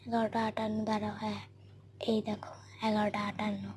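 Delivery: soft unvoiced whisper. Real, not acted. Showing viewers the clock near sleeping mother, whispering. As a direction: breathe the time out in a whisper.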